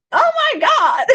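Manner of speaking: high squeal of disbelief breaking into a laugh; shriek, then laugh it off. Real, not acted. Livestreamer squealing at a chat message, then laughing.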